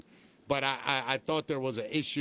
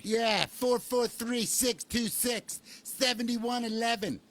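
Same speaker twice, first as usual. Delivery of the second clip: slow, much higher, sing-song phone number read; radio guy voice; 8 semitones higher, slower than usual. Pretend. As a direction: croon the number like an old-school radio DJ. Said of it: "if you wanna say it like a radio guy".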